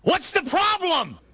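high, slow, full-voiced holler; yelling back. Jesus to the disciples over the storm, yelling back. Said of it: "he has to yell back".

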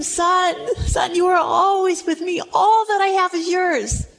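high, insistent appeal to a son; earnest father. Acted. Father's earnest reply to son acted.

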